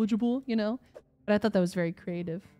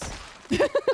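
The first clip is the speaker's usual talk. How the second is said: laughing; 3 dB louder than usual. Real, not acted. Self-deprecating joke laugh.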